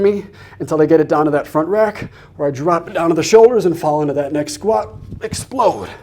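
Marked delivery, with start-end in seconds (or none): 5.2-5.9 higher pitch, dropping sharply; effortful one-word coaching cue; exertion call. Real, not acted. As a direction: push the word out with the lift. Calls out while lifting the weights.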